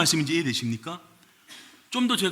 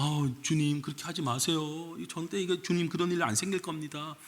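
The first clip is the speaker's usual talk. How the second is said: soft, pleading plea to the Lord; mock-humble pleading disciple; 3 semitones higher, 7 dB quieter than usual. Shown as a demonstration. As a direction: beg gently, softer and softer. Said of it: "이렇게 막 간청하고 막 이러는 것 같잖아요".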